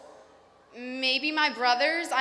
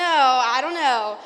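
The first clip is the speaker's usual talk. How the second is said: slow, stretched, louder I don't know with a falling end; drawn-out mock doubt; 10 dB louder, much slower than usual. Real, not acted. Teasing Duncan from Central before the crowd, drawn-out mock doubt. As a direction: drag it out, playfully doubtful. Teasing a fellow speaker onstage.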